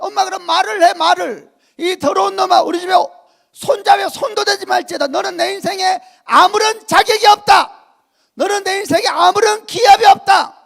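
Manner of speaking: a pastor teaching his mother to rebuke an evil spirit, loudly; preaching rebuke at full force. Acted. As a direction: cast the filthy spirit out with authority.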